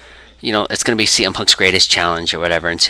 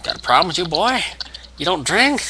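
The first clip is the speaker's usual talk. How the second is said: high, challenging mock wrestler voice; Stone Cold Steve Austin; 8 semitones higher than usual. Wrestler back on the mic to a non-drinker, mock taunting. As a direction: taunt with a rising question. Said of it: "and he's like".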